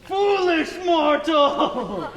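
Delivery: booming, slow villain proclamation; booming demon lord. Acted. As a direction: roar it like a mad high priest. Cultist priest threatens player.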